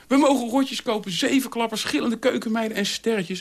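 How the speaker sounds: put-on high-pitched childlike glee; excited children. A narrator's reading. Two little boys running into the kitchen, excited. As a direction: burst out with delighted news. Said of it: "En ze dansten enthousiast".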